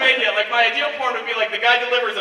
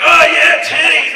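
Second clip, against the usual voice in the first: loud, raised mock-ecstatic cry; higher, louder, gleeful exclamation; excited outburst; 5 semitones higher, 9 dB louder, slower than usual. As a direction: burst out in lewd, hammed-up glee. Mimes himself mid-act, comic exclamation.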